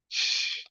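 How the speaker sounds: hushed hissing sound effect; mimicked squirt; imitated spurt. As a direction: let out a voiceless "shh" spray noise.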